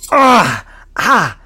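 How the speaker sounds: sharp high yelps as the knuckles scrape; cries of pain. Acted. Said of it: "All ten knuckles skin now".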